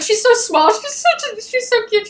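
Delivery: high-pitched adoring squeal; gushing. Real, not acted. Fan cooing over the small heroine, real gushing. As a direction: gush helplessly over something cute.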